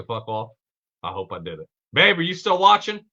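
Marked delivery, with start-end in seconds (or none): 1.9-3.0 loud, high call to someone in the next room; real call out to his wife mid-stream. Real, not acted. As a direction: raise your voice to reach his wife. Calling out to his partner.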